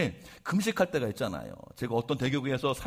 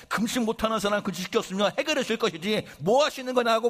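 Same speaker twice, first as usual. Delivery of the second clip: mock indignant higher protest to God; retold complaint; 6 semitones higher than usual. Pastor replaying how he argued with God while fasting, in a raised mock complaint. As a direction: argue back, higher, like a hungry man demanding answers. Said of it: "막 그냥 따졌어요".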